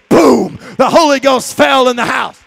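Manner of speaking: booming shout; loud proclamation. Acted. Preacher telling the church, a booming shout. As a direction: proclaim it at full force.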